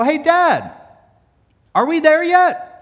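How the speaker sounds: put-on high, loud child voice calling to dad; whiny kid. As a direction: pipe up like a bored little boy. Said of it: "I'd finally pipe up to my dad and go".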